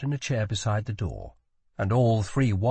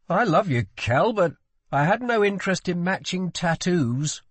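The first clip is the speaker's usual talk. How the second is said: high, louder, wry protest; exasperated affection in a raised pitch; exasperated prince; 8 semitones higher, 4 dB louder than usual. A narrator's reading.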